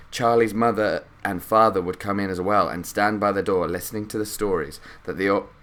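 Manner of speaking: ordinary delivery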